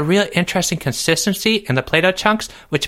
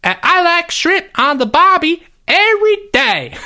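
much higher, louder hammed-up Australian accent; horrifying Australian accent; 12 semitones higher, 4 dB louder than usual. Doubling down after being mocked, a loud fake Australian accent. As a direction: push the bad Australian accent even harder. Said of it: "that was horrifying".

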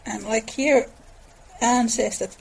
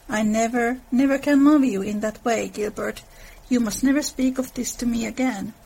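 ordinary talk, nothing marked

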